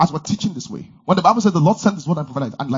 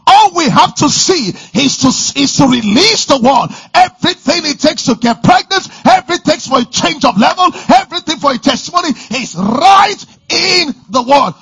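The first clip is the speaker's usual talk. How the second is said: loud, high, rousing preacher voice; preaching at full force; 9 semitones higher, 7 dB louder than usual. Acted. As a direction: drive it home to the congregation.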